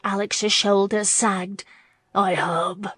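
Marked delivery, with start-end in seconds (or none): none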